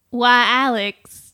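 slow halting try at a Southern drawl; an unsure go at a Southern mom voice; attempted Southern accent. Shown as a demonstration. Co-host attempts the mum's Southern accent on request. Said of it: "say this phrase in the mum's accent".

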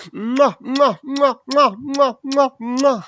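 high, loud smacking kisses; blowing an air kiss. Pretend. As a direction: kiss the tantrum away. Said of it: "one way to get the anger out".

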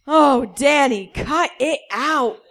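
slow, high, loud laughing moan; drawn-out hammed-up moan; slow, loud mock moan while laughing. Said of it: "he moaned".

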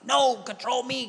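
high, insistent protest; mock pleading believer. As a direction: imitate a believer wailing back at God. Believer argues back with God.

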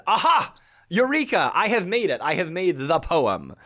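high, loud mock eureka moment; hammed-up triumphant exclamation; excited discovery. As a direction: cry out in triumph, acting it up. Acting out someone's sudden discovery.

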